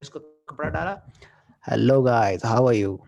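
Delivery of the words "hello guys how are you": lower, louder, right up on the mic; close-miked greeting demo. Shown as a demonstration. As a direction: lean in and speak close to the mic. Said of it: "अगर मुझे पास से बोलना है".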